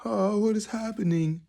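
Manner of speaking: high, tearful mock dismay; imitating crying viewer. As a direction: act out someone tearing up at slaughter footage. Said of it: "crying and they're like".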